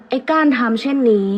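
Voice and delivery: ordinary delivery, nothing marked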